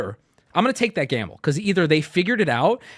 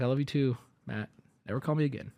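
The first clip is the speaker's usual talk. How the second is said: softer, understated phone reply; low-key reply; 3 semitones lower, 8 dB quieter, faster than usual. Retold or imitated. Streamer answering his friend's night-time call, understated reply. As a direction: mutedly play along, then brush off. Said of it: "I'd be like".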